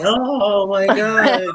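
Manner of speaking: acted scandalized groan; mock shocked reaction. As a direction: drag it out as an aghast onlooker. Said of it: "everybody's like".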